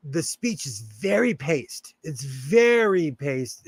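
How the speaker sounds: unhurried, measured Southern Californian pacing; slow, paced speech. Shown as a demonstration. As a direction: draw the words out slowly. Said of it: "if they talk a bit slower".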